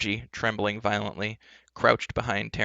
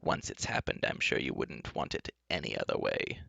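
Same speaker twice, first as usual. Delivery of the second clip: low, soft enchantress soothing her captives; reassuring; 11 semitones lower, 7 dB quieter than usual. Acted. Enchantress promising toad life is fine, reassuring. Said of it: "in a reassuring tone".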